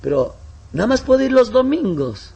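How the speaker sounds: high, pleading excuse voice; mock whiny convert. Preacher mimicking a convert who only comes Sundays, high whiny. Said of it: "Pero la persona dice".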